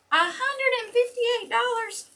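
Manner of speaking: very high, disbelieving question; incredulous. Real, not acted. Telling viewers about the rent increase, in high incredulous disbelief. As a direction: squeak out the figure in disbelief. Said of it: "outraged at the increase".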